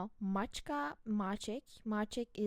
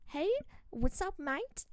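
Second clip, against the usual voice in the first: lively, higher Australian greeting; Aussie accent; 7 semitones higher than usual. Shown as a demonstration. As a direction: put on an Aussie "mate". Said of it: "for this Aussie accent".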